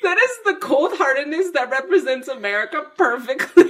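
high, loud, laughing disbelief; cracking up while ranting at American insurance; laughing outrage. Real, not acted. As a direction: rant through the giggles.

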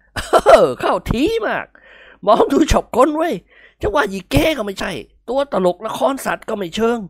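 amused old lord mocking the costume; old nobleman voice. A narrator's reading.

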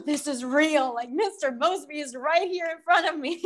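breathless, high-pitched excitement with a laugh; excited gasp. Retold or imitated. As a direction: relive the starstruck moment, gasping. Retelling shock of meeting idol.

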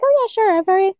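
high, breezy teacher voice; a light mock of an easygoing teacher; imitating the teacher. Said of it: "She's just like".

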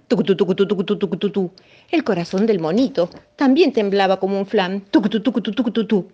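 heartbeat imitation. Narrator imitating the frightened heartbeat, rhythmic. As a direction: tap out the trembling heart.

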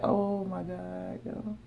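stunned hush. Retold or imitated. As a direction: let it fall out in disbelief, under your breath. Viewers at home, stunned.

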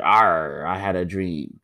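low growly pirate reciting a speech. Pretend. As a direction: say it like a pirate captain. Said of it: "M L K in a pirate voice".

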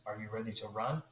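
put-on light South African accent. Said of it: "this is the best South African accent I have".

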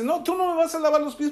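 high-pitched, rapid refusal; protesting Pedro. Retold or imitated. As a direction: act out an indignant disciple's protest, pitched well up. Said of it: "Aquí Pedro dice".

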